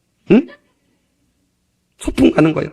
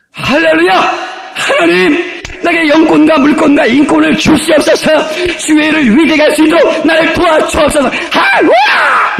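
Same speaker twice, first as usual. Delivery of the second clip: high, loud, jubilant prayer building to a shouted hallelujah; joyful loud prayer; 6 semitones higher than usual. Shown as a demonstration. Preacher demonstrating a joyful prayer to the congregation, high and loud. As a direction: pray like you mean every word, full of joy. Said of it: "이렇게 구해보면 얼마나 멋있어요".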